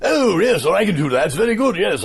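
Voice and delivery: put-on high British accent, echoing the other actor; imitated British accent. Actor showing his accent to the Churchill lookalike, imitated British. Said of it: "I did that back to him".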